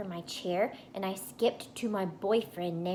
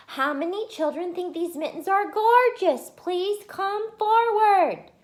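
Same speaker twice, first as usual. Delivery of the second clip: yelled; 11 semitones higher, 8 dB louder than usual. Acted. Junie yelling for children to come forward, loud and high. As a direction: yell for volunteers, stretching please come forward. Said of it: "I yelled".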